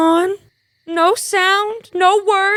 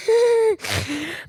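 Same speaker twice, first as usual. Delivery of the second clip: a soft, breathy laugh at his riddle; amused little laugh, quieter; light laugh; 4 semitones higher, 5 dB quieter than usual. Acted. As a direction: laugh him off gently. Hermia laughs at Lysander's wordplay.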